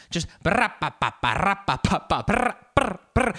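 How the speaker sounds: loud, high buzzing and popping; tone-deaf noise. Pretend. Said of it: "horrible pitch, completely tone deaf".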